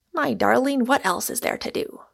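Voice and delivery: ordinary delivery, nothing marked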